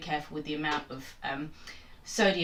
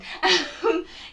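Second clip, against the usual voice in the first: high laugh; 12 semitones higher, 5 dB louder than usual. Real, not acted. Laugh after the rant.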